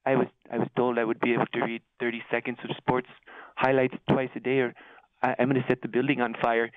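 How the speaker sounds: low mumbling disgruntled office drone; low, muttering put-upon office worker; Milton from Office Space. Retold or imitated. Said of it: "like that fat, ugly, redheaded guy Milton".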